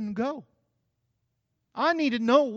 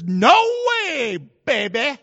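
sassy refusal; 3 semitones higher, 10 dB louder, slower than usual. Retold or imitated. Eve refusing the serpent, loud sassy no. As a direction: shut the snake down with attitude.

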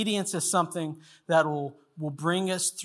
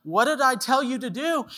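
raised, insistent acted question; fatherly challenge; 8 semitones higher than usual. The Lord questions his child mid-tantrum, as a challenging father. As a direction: press the question like an exasperated parent.

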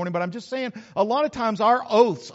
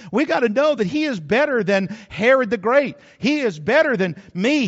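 full-force preaching; 3 semitones higher, 5 dB louder than usual. Acted.

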